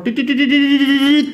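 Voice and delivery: high, mock beeping on "dit"; imitated pager beeps. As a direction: mimic a pager going off, high and quick. (tiruan bunyi pager, tinggi)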